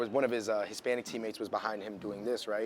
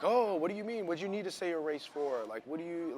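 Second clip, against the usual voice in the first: higher mock incredulous tone; mocking dismissive voice; 8 semitones higher, 4 dB louder than usual. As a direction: play it dismissive, brushing it off. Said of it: "be like".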